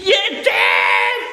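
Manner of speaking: low, loud shouted word. Real, not acted. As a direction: bellow the word Yetim. Shouted repeat.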